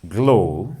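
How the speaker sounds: "glow" drawn out on its vowel; demonstrated word. Shown as a demonstration. Teacher giving an example of syllable stress, drawn-out demonstration. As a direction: say "glow" alone, stretching the long o. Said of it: "when I'm saying".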